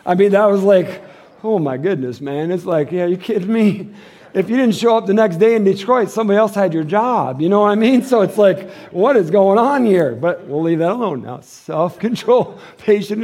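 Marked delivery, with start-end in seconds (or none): none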